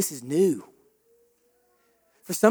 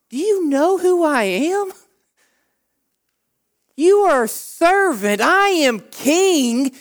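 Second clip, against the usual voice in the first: higher, drawn-out mocking king; scornful Pharaoh; 8 semitones higher, slower than usual. Pharaoh scorning Moses, high and dragged out. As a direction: look down on the servant from the throne. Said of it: "what does Pharaoh say".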